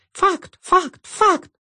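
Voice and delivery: tiny high squeaking voices; squeaky voices. A narrator's reading. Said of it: "Все они пищали".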